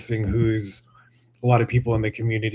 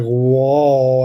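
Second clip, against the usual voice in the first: amazed whoa; 8 dB louder than usual. Real, not acted. Dazzled by the bright light.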